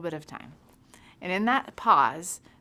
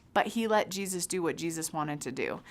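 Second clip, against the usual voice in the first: ordinary talk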